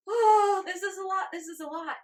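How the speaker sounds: high, loud panicked cry fading down; mock overwhelmed. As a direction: voice the frazzled nervous system, starting with a loud cry. Voicing overwhelmed nervous system.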